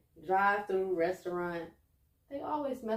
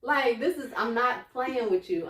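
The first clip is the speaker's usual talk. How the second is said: raised, emphatic retold plea; acted tearful protest; 2 semitones higher, 5 dB louder than usual. Begging her man to stop yelling, acted upset. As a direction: push through it insistently, playing hurt. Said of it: "I don't know why I'm crying".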